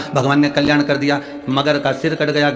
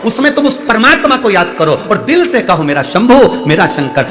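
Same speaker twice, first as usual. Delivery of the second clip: loud, raised sermon voice calling out to the crowd; fervent preaching; 5 semitones higher, 5 dB louder than usual. Acted. Guru urging the devotees to offer water anywhere, fervent loud preaching. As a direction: preach it full force, rousing the devotees.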